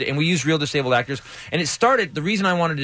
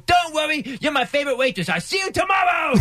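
much higher, hammed-up goofy shout; mock exaggerated shout; 14 semitones higher than usual. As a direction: yell it like a cheerful idiot. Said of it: "And Mike goes".

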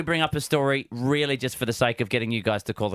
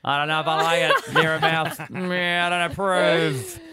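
disdainful; 4 semitones higher, 4 dB louder than usual. Acted. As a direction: turn your nose up at it. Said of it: "disdainfully flicking her pen like a cigarette".

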